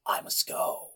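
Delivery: hushed, mock-manly declaration; imitated manly resolve, kept quiet; manly hero voice. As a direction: say it softly like a stoic hero. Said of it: "looks back at her all manly".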